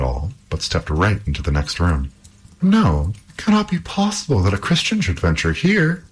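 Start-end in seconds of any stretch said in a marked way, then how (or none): 2.6-6.0 high-pitched exclamation of disbelief; disbelieving cry. A narrator's reading. Second princess to the arriving Halvor, disbelieving cry. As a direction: cry out high, as if it can't be true. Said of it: "cried the princess".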